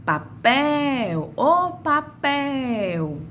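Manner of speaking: high, slow call of "papel", long on "pel"; calling out stretched. Shown as a demonstration. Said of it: "a sílaba de maior tonicidade é a pel".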